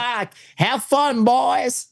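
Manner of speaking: high, slow, drawn-out put-on farewell; American policeman. Cop waves off the British shooters, high slow mock-American drawl. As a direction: imitate a cop's carefree send-off, pitched high. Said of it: "He was just like".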